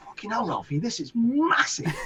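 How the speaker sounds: excited praise. Retold or imitated. Sasha runs over hearing the new track, mildly excited. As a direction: savour it like you can't believe it.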